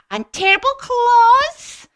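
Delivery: very high, loud and drawn out; slow, dramatic high mouse voice; mouse describing a monster. Acted. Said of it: "And the mouse said".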